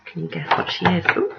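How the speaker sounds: ordinary delivery, nothing marked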